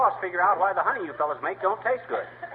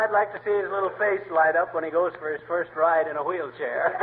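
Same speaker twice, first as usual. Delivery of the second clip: ordinary talk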